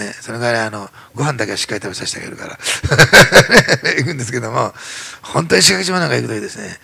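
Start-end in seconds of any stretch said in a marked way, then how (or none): 2.6-3.9 a loud burst of real laughter. Real, not acted. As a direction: laugh out at your own joke. Joke about feeding his son. (大きな笑い声)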